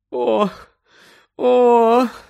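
high, loud moaning cry; anguished moaning. Acted. Mother moaning in grief, high and loud. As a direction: moan in grief. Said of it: "стогнала місіс Уізлі".

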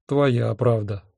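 ordinary delivery

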